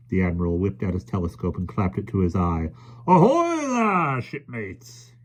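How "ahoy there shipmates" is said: slow, high booming ahoy; roaring hail. A narrator's reading. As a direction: hail them like a sea captain. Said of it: "he roared at the children".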